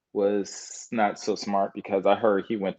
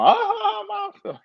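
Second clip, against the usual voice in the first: squeaky, much higher ha ha ha; mocking laugh at mama. Teasing his mama with a high mocking laugh. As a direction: cackle up high to tease her. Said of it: "laughing at mama".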